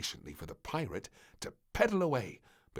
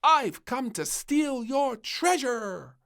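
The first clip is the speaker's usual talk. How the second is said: high, loud and slow; playful pirate threat; 10 dB louder, slower than usual. Acted. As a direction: draw it out like a pirate.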